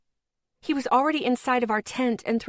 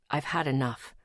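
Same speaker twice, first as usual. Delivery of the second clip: lower, softer male voice; lightly voiced boyfriend; 8 semitones lower, 5 dB quieter than usual. A narrator's reading. Boyfriend shuts Cassie down, lower and soft. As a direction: settle it, low and firm.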